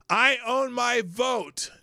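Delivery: slow, loud, high billboard proclamation. Retold or imitated. Host reads a billboard message to Obama, loudly proclaimed.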